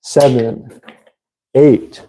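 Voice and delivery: ordinary delivery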